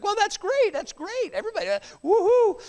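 high, sarcastic fake enthusiasm; mock cheer. Mocking people who post rainbow photos, fake cheer.

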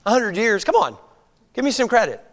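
ordinary delivery

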